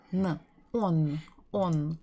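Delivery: on built from the letters O and N; sounding out. Shown as a demonstration. Teacher sounds out the syllable on for learners. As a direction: blend O and N into on. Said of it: "you're supposed to remember N".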